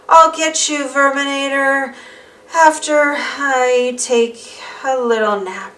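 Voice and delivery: slow, sleepy trailing threat; drifting off to sleep. Acted. Arthur tries to stay awake but nods off, slow and drowsy. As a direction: let the words sag as sleep wins.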